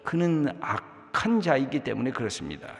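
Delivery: normal talking voice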